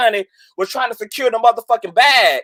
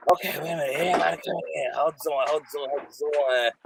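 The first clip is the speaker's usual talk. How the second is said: much softer, lower, half to herself; quietly busy; 5 semitones lower, 9 dB quieter, faster than usual. Real, not acted. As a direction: mutter while handling the screen. Managing callers joining.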